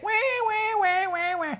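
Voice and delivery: high, thin, soft siren-like "wah"; mocking whine. Storyteller mimics the complaining women for children, mocking whine. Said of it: "hear somebody complain".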